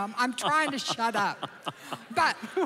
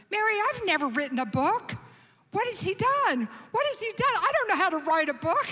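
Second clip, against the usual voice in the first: higher, rushed, fretting in mock panic; panicked; 7 semitones higher, faster than usual. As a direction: blurt it out like a man who's never written a book. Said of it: "He sent us into stress mode".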